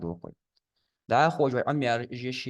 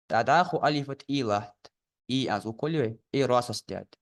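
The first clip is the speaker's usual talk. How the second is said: ordinary talk, nothing marked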